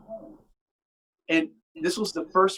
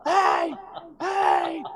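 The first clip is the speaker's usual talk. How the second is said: retold yelling. Glenn shouting over the band at rehearsal, high yell. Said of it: "he finally stops yelling".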